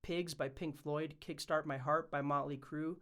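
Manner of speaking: ordinary delivery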